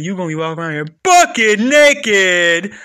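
loud, high teasing; loud mocking. Real, not acted. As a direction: belt out the mockery.

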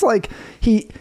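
usual speaking voice

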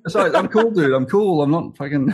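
high, laughing fake calm; mock cool. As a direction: pretend to keep it together while cracking up. Mocking forced calm, laughing.

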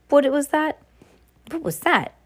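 breaks off high and sharp at a sound; jolted mid-sentence, voice jumps up; startled. A narrator's reading. McGonagall hears a rumble and breaks off, startled. Sudden noise interrupts McGonagall.

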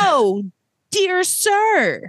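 high, prim dear sir; a hint of mock politeness; mock polite manager. Manager calming the screaming guest, mock prim. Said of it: "And I'm like".

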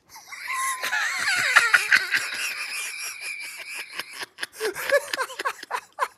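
cracking up completely; howling laugh. Real, not acted. Co-host erupts at punchline.

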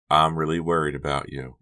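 ordinary delivery, nothing marked

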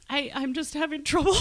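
ordinary delivery

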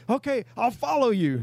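a hint of a grateful foreign boater; relieved boater. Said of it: "He goes".

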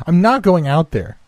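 high, pleading refusal; frightened cry. Acted. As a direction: refuse in panic, voice jumping high. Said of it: "she cried".

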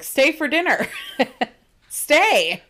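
high, loud, hammed-up begging; urgent pleading. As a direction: beg with exaggerated urgency, pitch shooting up. Rory begging Paris to stay.

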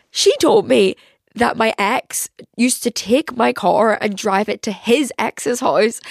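ordinary talk, nothing marked

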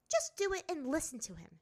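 high, sharp princess voice; a proud girl brushing it off; haughty princess. A narrator's reading. Azula orders Ty Lee.

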